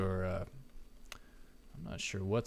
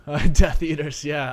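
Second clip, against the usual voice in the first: laughing; 6 semitones higher, 14 dB louder than usual. Real, not acted. Laughing at a thought.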